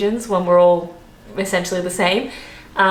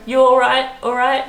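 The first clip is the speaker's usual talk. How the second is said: "all right" tossed out higher, as a greeting; imitated British greeting; 6 semitones higher than usual. Showing how Londoners greet each other, imitated casual "all right?". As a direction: imitate locals asking "all right" in passing. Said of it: "they also go".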